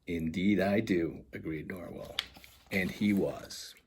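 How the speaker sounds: lively storybook reading voice; animated children's book narration; story narration. A narrator's reading. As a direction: read it like bedtime picture book. Author reads picture book aloud.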